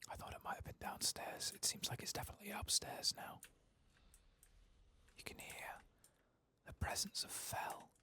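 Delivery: hushed stealthy whisper. Real, not acted. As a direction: creep and keep the voice down. Sneaking through the enemy house.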